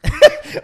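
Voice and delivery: a loud, high squeal of a laugh. Real, not acted. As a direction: let out a shrieking laugh. Laughing at being called old. (risa chillona y fuerte)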